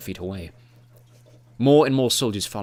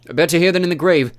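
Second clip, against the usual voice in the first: loud, higher shout over the fighting; battle shout; 8 semitones higher, 9 dB louder than usual. A narrator's reading. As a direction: yell it across the street. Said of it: "he shouted".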